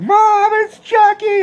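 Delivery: put-on six-year-old screaming for mom at a Chucky trailer; terrified scream. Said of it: "and I screamed".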